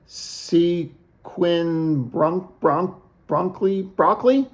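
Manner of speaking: mock fumbling of the name, slowly reaching "Broccoli?"; Nico. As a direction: stumble through "Bronk-, Bronk-, Bronkley", then pitch "Broccoli?" up as a question. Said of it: "or as Nico would say".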